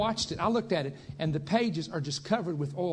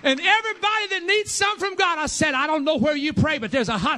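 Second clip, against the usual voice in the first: much higher and louder, fiery preaching; preaching shout; 13 semitones higher, 11 dB louder than usual. Real, not acted. Preacher roaring at the congregation, full shout. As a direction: shout it to the back of the church.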